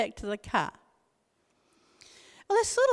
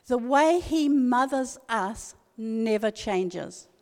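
ordinary talk

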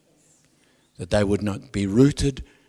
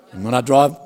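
ordinary talk, nothing marked

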